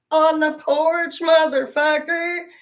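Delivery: high, loud and slow, stretching every word; slow triumphant yell. Retold or imitated. Telling her brother where the trap is, a slow loud gloat. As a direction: savour each word as you shout it.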